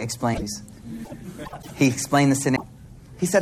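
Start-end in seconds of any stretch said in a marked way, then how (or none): 0.0-0.6 gentle prophet. Acted.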